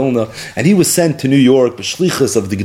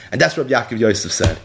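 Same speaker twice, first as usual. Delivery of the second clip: ordinary talk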